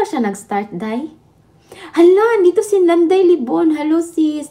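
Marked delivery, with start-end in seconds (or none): 1.7-4.5 high, loud delighted welcome; excited greeting. Real, not acted. Host spotting a viewer arrive on stream, excited greeting. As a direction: exclaim it high and loud as a guest turns up.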